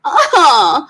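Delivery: loud drawn-out whiny "ah", high then falling; big mock groan of a balking kid. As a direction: imitate a child groaning over homework. Imitates son balking at homework.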